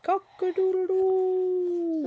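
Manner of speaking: high, slow crowing cock-a-doodle-doo; a playful storybook rooster call. A narrator's reading. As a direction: crow it like a cockerel. Animal sound in picture book.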